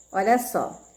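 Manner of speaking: usual speaking voice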